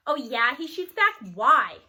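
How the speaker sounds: loud challenging retort; sharp comeback. Acted. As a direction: fire the question back loud. Barnstorm shoots back.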